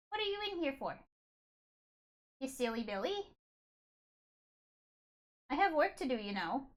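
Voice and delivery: high, sing-song voice for the cat. Real, not acted. Baby talk to her cat.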